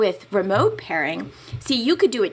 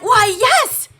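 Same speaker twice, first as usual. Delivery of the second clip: delighted; 13 semitones higher, 8 dB louder than usual. Retold or imitated. Retold delighted reply.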